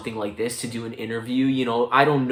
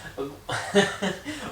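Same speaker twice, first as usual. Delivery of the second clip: a genuine, high laugh on the phone; real laugh; 9 semitones higher than usual. Real, not acted. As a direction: laugh along with the caller.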